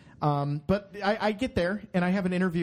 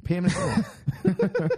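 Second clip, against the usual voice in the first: slow, laughing repeat, higher; 13 semitones higher, slower than usual. Real, not acted. Repeating his friend's joke, laughing. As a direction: laugh through the words.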